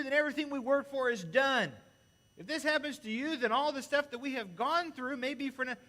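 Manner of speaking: loud, high, pleading protest; hammed-up panicked friend yelling; frantic rebuke. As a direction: Peter shouting at Jesus in alarm. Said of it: "began to rebuke Him".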